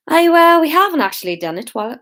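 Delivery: attempted Scot slipping into Irish; accidental Irish accent. Shown as a demonstration. Hamish defending himself to the meeting, mistakenly Irish accent. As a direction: start high and loud, then hurry on in Irish. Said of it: "oh no, that was Irish".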